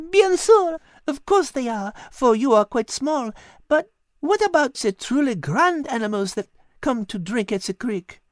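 put-on high, lilting French-accented cat voice; a wise cat coaxing gently; cat Liz. Cat Liz coaxing Patrick in a high French-tinged voice.

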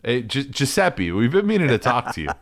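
a boss dressing down his waiter; hammed-up stern manager; mock restaurant boss. Restaurant boss scolding waiter Giuseppe.

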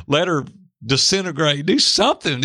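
retold prayer, increasingly high-pitched; uncomfortable pleading. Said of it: "it was uncomfortable. It was like".

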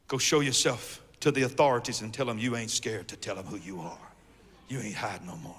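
low, very soft instruction; hushed command. Acted. God sends the prophet to the authorities, hushed. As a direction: drop right down, almost to a murmur. Sermon point delivered in hush.

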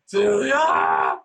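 loud drawn-out call of her name; a mother bellowing her daughter's name; mother's shout. Retold or imitated. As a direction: yell the name like an angry mom across the house. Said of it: "You could hear it, like, vibrate through the universe".

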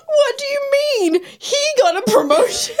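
high, squeaky protest through laughter; crying with laughter. Retold or imitated. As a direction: barely get it out for laughing. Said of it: "crying at this point".

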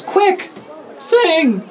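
put-on very high-pitched child's yell; yelling kid. Game master as the kid commanding his Pokemon, high yell. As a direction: yell an urgent command as a little boy. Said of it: "And he yells".

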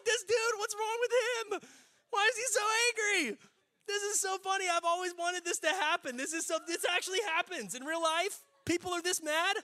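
very high, squealing amused voice; mock laughing hysterically. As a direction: imitate cracking up in disbelief. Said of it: "I am laughing my butt off".

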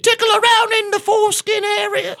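shrieking, very high mock British; British accent. Crude offer to co-host, screeched fake British accent. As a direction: shout it in a piercingly high, silly British voice. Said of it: "Can you do a British accent?".

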